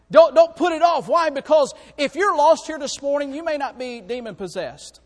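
loud, high, urgent preaching; fiery preaching. Acted. Preacher urging the lost not to delay, shouting. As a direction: drive it home from the pulpit.

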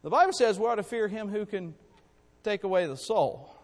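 ordinary talk

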